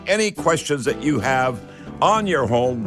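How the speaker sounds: ordinary talk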